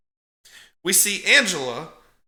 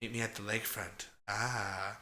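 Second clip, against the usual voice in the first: much softer and lower, a sinister hush; creepy hushed Angela; 7 semitones lower, 11 dB quieter than usual. Retold or imitated. Angela luring Paul to the lake, softly sinister. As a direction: breathe it like a creepy invitation. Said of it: "And then she's like".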